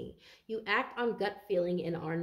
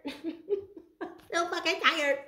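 laughing complaint; 8 semitones higher, much slower than usual. Real, not acted. Complaining about being tired.

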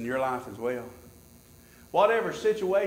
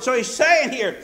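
high, loud preacher's cadence; fervent preaching; 10 semitones higher, 9 dB louder than usual. Real, not acted. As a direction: preach it out with rising fervor. Preaches at full force.

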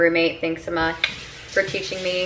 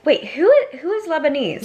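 much higher, excited double take; retold startled realisation, pitched up; sudden surprise; 9 semitones higher than usual. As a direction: catch yourself mid-thought, amazed. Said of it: "And so I was like".

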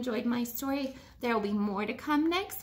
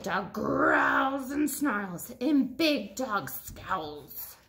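slow, lightly growled dog words; a hint of a snarl; growly; 5 semitones lower, much slower than usual.

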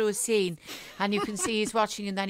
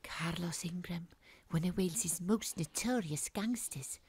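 hushed inner narration; 3 semitones lower, 6 dB quieter than usual. Acted. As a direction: murmur the thought to yourself, hushed.

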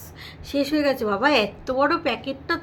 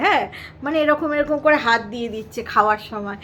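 ordinary talk, nothing marked

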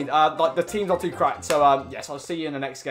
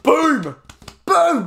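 higher, louder exclamations; triumphant boom; 9 semitones higher, 5 dB louder than usual. Real, not acted. Gamer celebrating a strike, loud booms. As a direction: punch each boom.

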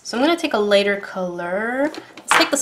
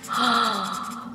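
gasp; 6 dB quieter than usual. Real, not acted. Gasp at color result.

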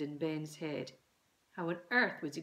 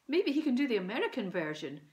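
excited exclaim; 5 semitones higher, 6 dB louder, faster than usual. A narrator's reading. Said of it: "exclaimed Mum".

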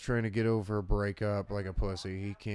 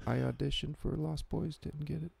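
higher, soft, hesitant impression; Brendan Fraser; 7 semitones higher, 3 dB quieter than usual. Star recalling his lost career, high and soft. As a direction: trail haltingly into the mic. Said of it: "he, like, talks like this into the mic".

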